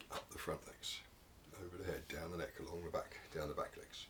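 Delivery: whispered counting, hushed and careful; tense whisper. Pretend. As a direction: breathe it out like the room is frozen. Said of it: "atmosphere's really getting tense now".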